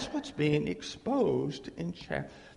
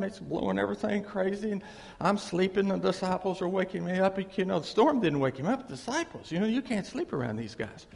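normal talking voice

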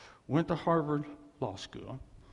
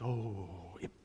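lower, soft near-whisper; old missionary answering the pastor in a hush; hushed retold answer; 7 semitones lower, 9 dB quieter, much slower than usual. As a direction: breathe it out quietly, in awe. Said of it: "He goes".

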